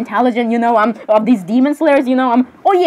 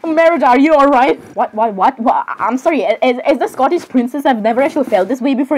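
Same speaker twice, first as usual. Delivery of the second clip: normal talking voice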